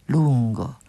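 ordinary delivery

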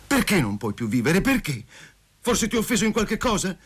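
high, urgent pleading questions; agitated questioning. A narrator's reading. Rejected lover asks what he did wrong, agitated. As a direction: demand the reason, pitch climbing high. Abandoned man demands reasons.

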